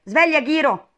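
loud, high wake-up call; shouting. A narrator's reading. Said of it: "gridarono gli altri due". (grido per svegliare qualcuno)

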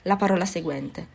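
usual speaking voice

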